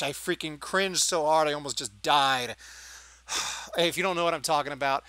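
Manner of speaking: normal talking voice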